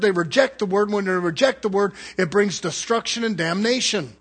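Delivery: fiery pulpit preaching; full-force preaching. Acted. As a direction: preach it hard to the congregation.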